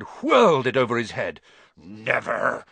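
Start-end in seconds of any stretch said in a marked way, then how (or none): none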